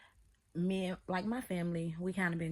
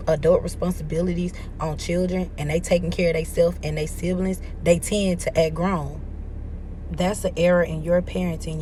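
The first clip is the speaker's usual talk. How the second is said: loud; 12 dB louder than usual. Real, not acted. Heated rant about cousin's parenting.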